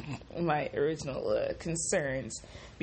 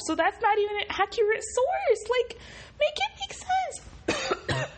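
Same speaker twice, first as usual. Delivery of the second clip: exasperated squeak. Real, not acted. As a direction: voice climbing into a very high squeal. Ranting at racist logic.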